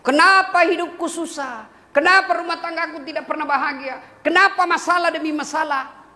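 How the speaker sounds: complaining husband. Retold or imitated.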